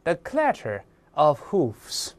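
every word of "the clatter of hoofs" drawn out and spaced; teacher reading an example to the class slowly. Shown as a demonstration. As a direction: read the example phrase slowly, like dictation. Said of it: "咱看个简单例子".

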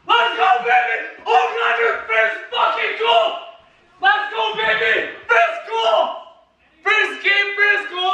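high, shouted fan celebration; real ecstatic hockey-fan yelling; goal celebration. Real, not acted. Celebrating player's first goal.

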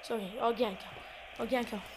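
low, very soft voice; hushed. Real, not acted. Gamer commentating while mom wants to sleep, hushed. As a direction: murmur it so nobody wakes.

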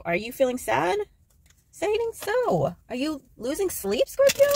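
high, exaggerated teasing worry; mock concern. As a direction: play up the fake sympathy, voice climbing high. Teasingly acts concern at sad card.

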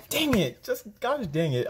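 high, sudden exasperated curse; frustrated outburst. Real, not acted. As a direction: burst out in regret, then ease. Regret over lost catch.

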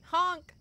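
sharp high honk; loud honk. Pretend. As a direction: blare out a honk. Expecting a honk.

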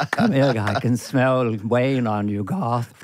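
low, timid Garth voice; mock-nervous Garth, dropping low; Garth impression. Dana doing Garth, hiding from Wayne. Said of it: "I would do Garth".

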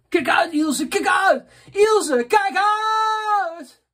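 high, loud shouted Kijk uit, Ilse; warning shout. Shown as a demonstration. Performing the viewer Ilse's request, a shrill loud warning shout. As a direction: yell it like she's about to get hit. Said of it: "Beste koning [name], van Ilse".

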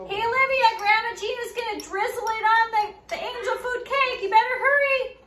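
high, loud call across the house. Real, not acted. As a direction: call her over from another room. Calling the child to come.

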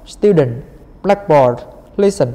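"Student", "blackboard", "listen" each strong up front, falling after; first-syllable stress. Shown as a demonstration. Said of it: "Còn lại là một". (nhấn âm đầu của "Student", "blackboard", "listen")

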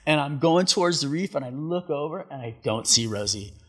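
ordinary talk

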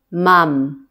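"mam" closed on a held final m; final-consonant drill. Shown as a demonstration. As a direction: say "mam" loudly and carefully, lips shut on m. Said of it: "七个尾音都发音看看".

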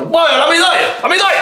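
shouting about the medal; excited cheering. Acted. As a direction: cheer at the screen.

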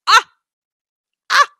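two short, sharp cries; excited yelps. Real, not acted. Reacting to the trailer's surprises, excited yelps. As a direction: yelp in delighted shock.

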